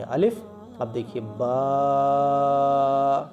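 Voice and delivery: letter names stretched long and slow; drawn-out letter names. Shown as a demonstration. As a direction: draw out الف and با in one breath. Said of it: "کھینچ کھینچ کر پڑھیں گے". (حروف کو کھینچ کر لمبا پڑھنا)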